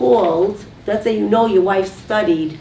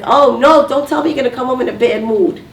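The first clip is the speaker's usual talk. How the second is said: loud, rapid mock protest; head off bad news in a rush; acted self-centred complaint; exasperated wife; 3 semitones higher, 4 dB louder, faster than usual. Shown as a demonstration. Self-centred wife, contrast with sympathy.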